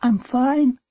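put-on high-pitched, boyish reply; high, eager-to-please reply; small brave answer. A narrator's reading. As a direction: answer your mother in a high little voice. Choked-up boy answers his mother.

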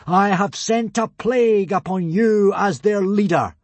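grand thunderous sentence; solemn boom. A narrator's reading. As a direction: pronounce judgment like a god. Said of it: "boomed Hiccup solemnly".

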